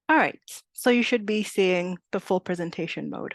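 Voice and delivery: ordinary talk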